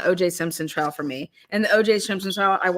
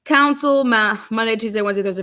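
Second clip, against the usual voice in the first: normal talking voice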